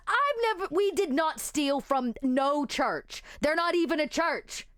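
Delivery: Bryce's voice. Acted. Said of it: "Bryce says".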